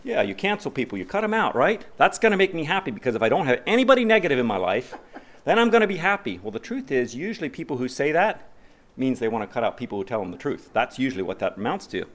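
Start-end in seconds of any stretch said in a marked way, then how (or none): 0.0-6.4 mocking self-talk.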